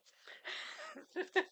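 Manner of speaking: very high burst of laughter; relieved laugh. Real, not acted. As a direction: laugh the sadness away. Breaks the sad mood.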